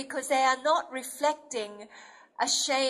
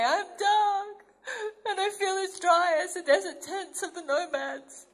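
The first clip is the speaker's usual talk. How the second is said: higher, tearful confession; ashamed bride of the Song of Solomon; insecure bride; 6 semitones higher than usual. Acted. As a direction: bride admitting she feels unworthy. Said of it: "would come to him and say".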